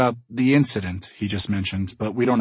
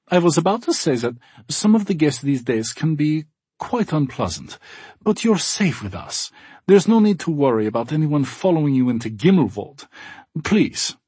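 put-on cheerful German accent; 5 semitones higher, 3 dB louder than usual. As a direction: welcome guests warmly, German accent. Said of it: "Gunther says".

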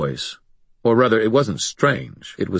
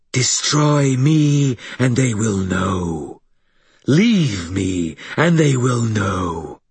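slow, higher, louder threatening monster; alien monster voice; 4 semitones higher, 3 dB louder, much slower than usual. Acted. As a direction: drag out each threat like doom. Sharkface threatens Harry.

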